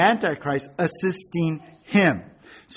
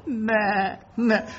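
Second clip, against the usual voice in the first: high bleating lamb; 5 semitones higher than usual. Retold or imitated. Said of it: "you think the lamb will go".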